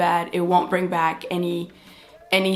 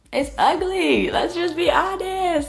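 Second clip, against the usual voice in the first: high, slow disbelieving whine; disgusted; 9 semitones higher, slower than usual. Real, not acted. Looking at her own dark eyebrows, drawn-out disgusted whine. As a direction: drag it out in horror.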